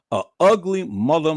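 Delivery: ordinary talk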